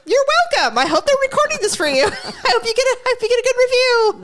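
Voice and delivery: high, gushing mock thanks breaking into laughter; retold playful reply. Caller hoping the county worker gets reviewed, playful reply. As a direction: pitch it way up and giggle through it. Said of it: "And I'm like".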